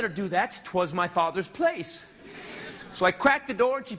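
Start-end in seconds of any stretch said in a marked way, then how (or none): none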